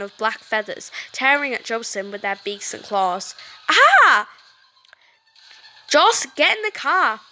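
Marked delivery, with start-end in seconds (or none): 3.7-4.3 high loud shriek; pained scream. Acted. Jocelyn screams as crows claw her. 5.9-7.2 louder, higher urgent yell; urgent shout. Acted. Cody yells at Jocelyn to flee, urgent.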